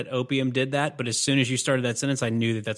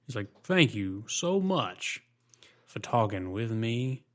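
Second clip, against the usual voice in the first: very slow Southern-aristocrat drawl, trailing softer; Doc Holliday; 2 semitones lower, 5 dB quieter, much slower than usual. Retold or imitated. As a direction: draw each word out, with long pauses. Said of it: "the Doc Holliday voice".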